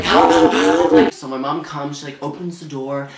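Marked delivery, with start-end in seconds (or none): 0.0-1.1 loud desperate calls for help; calling for help. Retold or imitated. Teen shouting to family for help. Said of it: "So I'm like".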